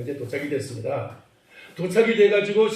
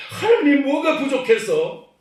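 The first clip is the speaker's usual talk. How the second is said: higher and slowed, leaning on 뭐가; emphatic slow appeal; 8 semitones higher, 4 dB louder, slower than usual. Real, not acted. Preacher to congregation, slow emphatic 뭐가. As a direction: draw out 뭐가 부족해서 like a plea.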